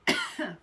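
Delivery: short sharp cough; real cough, still choking. Real, not acted. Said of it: "Sorry, I was choking really bad".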